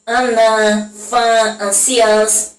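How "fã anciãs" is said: "fã, anciãs" slow, with the nasal "ã" drawn out; nasal a demo. Shown as a demonstration. Teacher showing pupils the nasal sound. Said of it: "Vocês podem ver aí o som do A como fica diferente".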